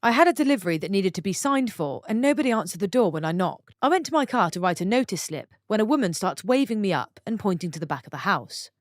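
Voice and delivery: plain reading voice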